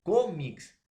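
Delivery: cómics with CÓ punched high and loud; stress on CÓ. Shown as a demonstration. Teacher giving grave word examples, punching CÓ in cómics. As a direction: hit the first syllable of cómics hard. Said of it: "grave termina en S".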